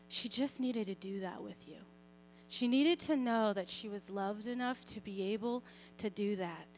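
soft, low, tender explanation; gentle voice of God. Retold or imitated. The Lord explaining the child's need to mom, soft and gentle. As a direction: explain it quietly and kindly. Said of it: "I felt like the Lord was like".

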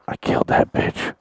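whispered confession; acted, breathy secret admission; imitated whispering. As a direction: hiss it in a stage whisper. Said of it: "he's constantly whispering like this".

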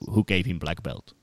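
put-on a light Russian accent, deadpan; stone cold Russian accent. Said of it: "in that stone cold Russian accent".

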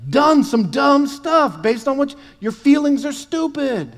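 high, loud, laughing sermon shout; shouting preacher. Acted.

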